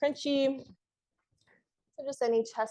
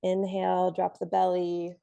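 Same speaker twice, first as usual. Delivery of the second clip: ordinary delivery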